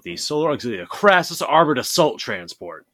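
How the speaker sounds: loud, punchy full name; all caps. Shown as a demonstration. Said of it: "if you don't read this in all caps".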